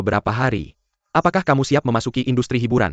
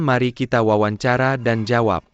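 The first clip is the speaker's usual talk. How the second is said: ordinary delivery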